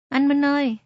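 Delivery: loud frantic call from behind. Acted. Little Còi calls after Minh, loud and frantic. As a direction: shout his name to stop him. Boy runs up calling out.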